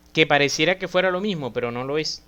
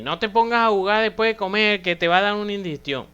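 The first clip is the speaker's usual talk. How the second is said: higher-pitched, hurried parental nagging; mock parental warning, pitched up; parent's warning; 7 semitones higher, 4 dB louder, faster than usual. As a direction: imitate a parent scolding a child in one quick run. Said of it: "te decían tus padres".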